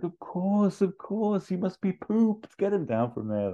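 very high, slow gushing voice; super friendly Ferrell. Retold or imitated. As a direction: gush it out like an overfriendly host. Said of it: "He's like".

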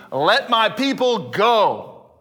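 slow, solemn "Let my people go"; solemn command. Retold or imitated. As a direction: deliver it like a divine decree, drawn out. Moses voicing God's command.

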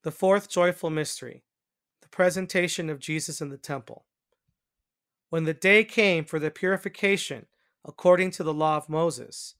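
formal, lifted reading with falling phrase ends; scripture reading. A narrator's reading. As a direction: announce and read scripture in deliberate, separated phrases. Reads the mystery and scripture.

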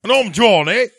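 loud, high mock Liverpool shout. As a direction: blurt it out high in caricatured British. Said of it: "What does [name] sound like?".

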